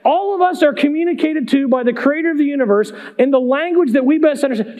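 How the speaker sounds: usual speaking voice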